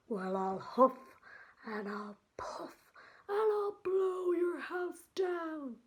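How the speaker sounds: big bad wolf roaring his threat; wolf shout.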